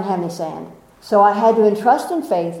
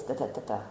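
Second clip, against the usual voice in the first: imitated roller coaster rattle; 8 semitones lower, 12 dB quieter, much faster than usual. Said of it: "I was like this".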